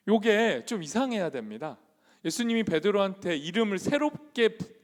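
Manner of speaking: ordinary talk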